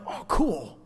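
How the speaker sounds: ordinary talk, nothing marked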